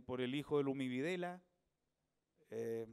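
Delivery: ordinary talk, nothing marked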